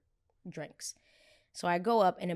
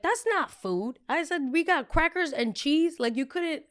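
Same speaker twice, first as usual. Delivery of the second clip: indignant disbelief; 9 semitones higher, 4 dB louder than usual. Retold or imitated. Indignant at cheese-and-cracker buffet.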